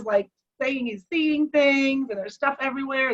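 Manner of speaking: ordinary talk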